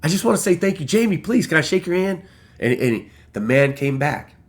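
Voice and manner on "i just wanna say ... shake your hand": excited pleading. Retold or imitated. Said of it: "I'm like".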